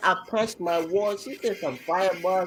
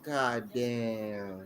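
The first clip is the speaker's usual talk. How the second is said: stunned low reaction; 7 semitones lower, 8 dB quieter than usual. Retold or imitated. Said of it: "I'm like".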